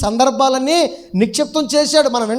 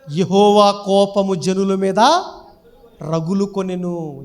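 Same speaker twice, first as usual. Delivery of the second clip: very slow, solemn verse recital; preacher intoning scripture slowly; scripture reading; much slower than usual. Acted. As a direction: draw out each word of the verse. Reads scripture aloud.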